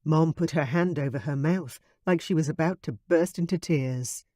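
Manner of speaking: usual speaking voice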